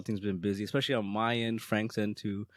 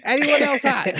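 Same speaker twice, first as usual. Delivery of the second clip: louder, higher mock plea to the room; calling out; 12 semitones higher, 6 dB louder than usual. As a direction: repeat it as if nobody heard, amused. Repeats the ignored question, escalating.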